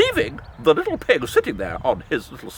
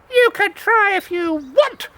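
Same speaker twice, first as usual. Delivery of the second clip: high, louder daring pig; high cheeky pig; 8 semitones higher, 4 dB louder than usual. Acted. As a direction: piglet daring the wolf.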